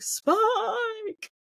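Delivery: long, high-pitched mock cry; mock scream. Mimicking a father calling out to the mainland, drawn-out mock scream. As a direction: draw the call out high, without real volume. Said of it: "have him scream out to the mainland".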